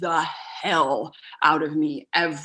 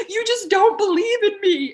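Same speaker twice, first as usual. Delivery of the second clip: high, louder hurt accusation; higher, louder wounded whine; accusing whine; 13 semitones higher, 7 dB louder than usual. Retold or imitated. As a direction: plead like you feel unsupported. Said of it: "where I'd be like".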